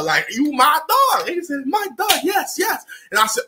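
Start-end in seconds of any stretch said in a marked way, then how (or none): 1.7-2.8 high, eager host voice; lightly voiced generous host; imitating the restaurant guy. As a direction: pitch up and push the food on him.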